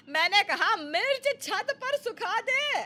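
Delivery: mother-in-law insists to her daughter-in-law; exasperated repeat. Acted. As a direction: spell out the order in frustration. Mother-in-law repeats order again.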